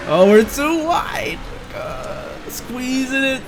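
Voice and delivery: high, tense strained voice; strained excitement. Real, not acted. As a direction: strain through the tight corner. Tense moment in a corner.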